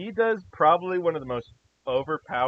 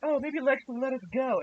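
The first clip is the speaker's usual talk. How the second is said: mock naive prisoner; 10 semitones higher than usual. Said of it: "you're like".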